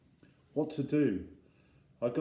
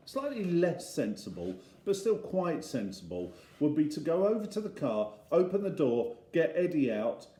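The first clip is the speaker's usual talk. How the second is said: usual speaking voice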